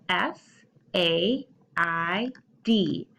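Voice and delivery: letters spaced out one by one; spelled out slowly. Shown as a demonstration. Teacher spelling to kids, slow and clear. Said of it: "spell it with me".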